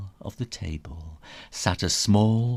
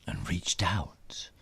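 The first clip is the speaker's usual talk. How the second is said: hushed suspense; 6 semitones lower, 8 dB quieter than usual. A narrator's reading. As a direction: whisper sinking low. Reaching for the door.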